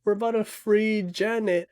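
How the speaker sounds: high-pitched imitation of frightened begging; scared pleading. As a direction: act out pleading for your life. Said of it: "And I was so scared".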